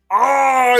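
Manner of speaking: high, loud comic wail; hammed-up transformation howl; mock anguished cry. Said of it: "be like".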